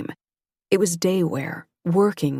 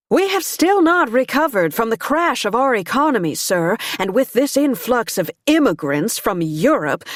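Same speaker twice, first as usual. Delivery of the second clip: a proud lady's speech rising higher and louder; fiery society woman; 5 semitones higher, 3 dB louder than usual. Acted. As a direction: preach to the room with conviction. Abigail's long political speech.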